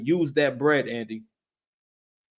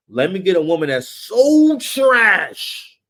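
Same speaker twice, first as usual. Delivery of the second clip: drawn-out, sleazy put-on voice, slowed down; slow it down and sneer it like a sleazy dude; mocking Andy's voice; 3 semitones higher, 5 dB louder, slower than usual. Mocking Andy's thinking, slow and sneering. Andy's inner thought, mocked.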